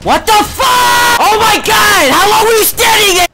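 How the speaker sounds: high loud shocked yell; startled shout. Real, not acted. Gamer spotting an enemy right behind, shocked shout. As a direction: jump out of your skin.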